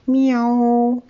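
high, loud, clear cat meow; drawn-out high meow; cat's meow. A narrator's reading. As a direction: imitate a cat calling out clearly. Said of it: "ganz leise wohl, aber deutlich".

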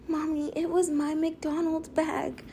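put-on high, soft, slow child voice; mother mimicking her daughter's small confession; imitating little girl. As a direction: play the small kid owning up.

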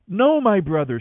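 loud, high, pleading cry of refusal. A narrator's reading. Tamar pleads with her brother, loud high desperate cry. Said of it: "she cried".

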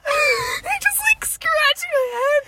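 very high, squeaky with laughter; laughing. Real, not acted. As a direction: barely get it out through giggles. Storyteller cracking up at own story.

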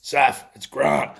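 loud, drawn-out coach's phone greeting; Grant Russell. Acted. Coach rings his sleeping player, slow loud impression. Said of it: "That's my best Grant Russell voice".

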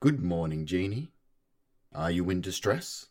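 AI assistant voice. Acted. AI assistant speaks.

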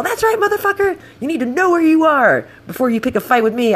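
high, triumphant angry re-enactment; retold pissed-off shout. Rider crowing at cowed driver, retold angry shout. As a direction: yell it after a retreating enemy, voice way up. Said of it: "I was so pissed".

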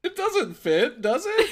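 squeaky high teasing question. Pretend. Teasing a co-host about her theme song, high mock tone. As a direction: needle her, all sing-song.

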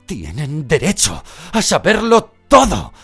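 loud breathless gasp; panted. A narrator's reading. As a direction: pant it out while grappling. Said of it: "jadeó Lupin".

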